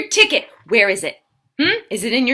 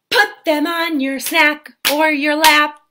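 ordinary talk, nothing marked

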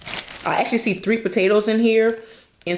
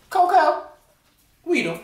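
high call to the dog; 10 semitones higher than usual. Real, not acted. Calling out to the dog.

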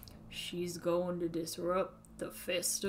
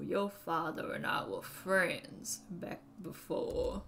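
usual speaking voice

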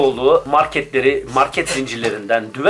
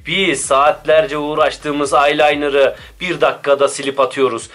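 ordinary talk

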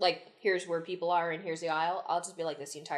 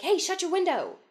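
snapping at a neighbour; 10 semitones higher, 3 dB louder than usual. Retold or imitated. Retold plane neighbour quote.

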